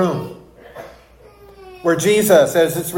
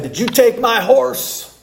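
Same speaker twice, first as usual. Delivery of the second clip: cowboy accusing; 7 semitones higher, slower than usual. Retold or imitated. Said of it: "and said".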